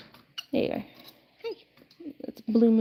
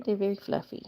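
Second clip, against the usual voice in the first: ordinary delivery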